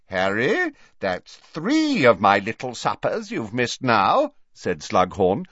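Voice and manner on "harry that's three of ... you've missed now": hearty, higher-pitched put-on voice; larger-than-life jolly old host; genial booming professor. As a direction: scold him jovially, pitch swooping up. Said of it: "poking him genially".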